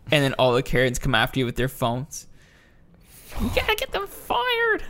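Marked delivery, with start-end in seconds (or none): 3.4-4.8 very high pretend Karen screech; mock Karen. Friend pitching a game, voicing a Karen in a squeal. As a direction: shriek like an entitled customer.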